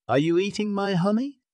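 high, rising question from the lion; suspicious question. A narrator's reading. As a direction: ask suspiciously, pitch climbing high. Lion suspects monkey of eating.

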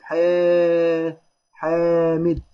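long held "ḥaaa" in حا and حامد; madd with alif. Shown as a demonstration. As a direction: stretch حامد as "ḥaaa-mid". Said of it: "حا مد بالألف".

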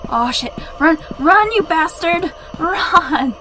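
panicked high shouting; scared horror game streamer. Real, not acted. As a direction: scream in real fear, running away. Monster chases again, panicked yelling.

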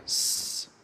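"س" as a sustained sss; S sound alone. Shown as a demonstration. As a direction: demonstrate "س" as just the S consonant, held without a vowel. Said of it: "اللي هو كده". (نطق "س" صوت سين ممدود لوحده)

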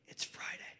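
hushed, confidential whisper; whispered aside. Acted. Comic excuses his late night out in a whispered aside. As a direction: slip it in under your breath.

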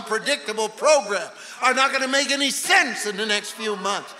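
loud revival preacher, voice climbing to a shout; fired-up pulpit shouting; full-force preaching. Real, not acted.

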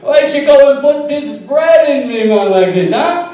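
high, loud toaster with a put-on Italian-Jamaican lilt. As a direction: play the half Italian, half Jamaican toaster, outraged. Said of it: "it was just like".

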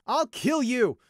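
high, furious scream; full-out enraged shriek; screamed threat. A narrator's reading. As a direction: scream it in sudden murderous fury. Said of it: "he screamed".

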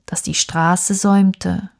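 plain reading voice

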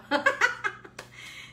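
bursting into a high giggle; high laugh. Real, not acted. Baker laughing at her own mishap, high giggle.

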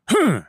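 high, loud snooty hmm; a sharp, judging hum aimed at me; disapproving hmm. Retold or imitated. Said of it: "She gives me a".

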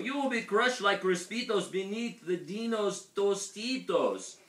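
high, loud cry of dismay; wailing warning. A narrator's reading. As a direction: wail out a dire warning. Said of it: "wailed Scipito".

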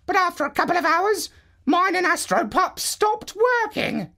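a calm, high parrot giving advice. Acted. Parrot tells Marlowe to remove goggles, high bird voice. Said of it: "Eventually he told me".

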